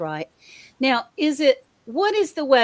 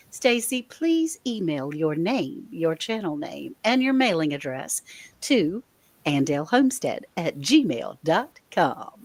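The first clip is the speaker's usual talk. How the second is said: radio voice; 5 semitones lower than usual. Pretend. Host gives the giveaway winner email instructions, playful radio voice. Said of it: "Using my radio voice there".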